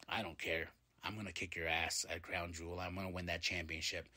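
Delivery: soft, low menacing threat; mock intimidating. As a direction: quietly threaten your rival. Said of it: "Very, very intimidating indeed".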